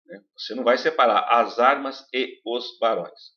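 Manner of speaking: ordinary talk, nothing marked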